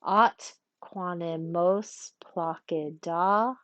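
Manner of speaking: slow, measured Latin half-line; metrical scansion. Shown as a demonstration. Teacher restarts the line after noting an elision, slow metrical reading. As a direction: lay out each syllable deliberately. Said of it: "we're gonna practice a little bit".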